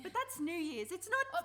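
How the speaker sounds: loud, high, flustered objection; loudly insisting New Year's doesn't count; defensive protest. Acted. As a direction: rush to deny it.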